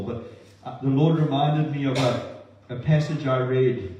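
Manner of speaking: usual speaking voice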